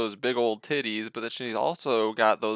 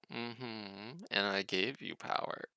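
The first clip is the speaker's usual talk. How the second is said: a hint of a scary sea creature; underwater voice; 12 dB quieter, slower than usual. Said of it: "really scary, good underwater voice".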